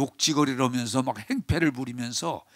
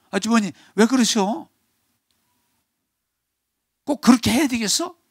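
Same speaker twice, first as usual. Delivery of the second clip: pleading rebuke; 8 semitones higher, 4 dB louder than usual. Retold or imitated. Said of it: "이런 말을 했어요".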